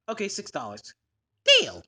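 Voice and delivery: lightly voiced low shopkeeper; a hint of the low manager. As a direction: drop down into the manager. Manager gives in.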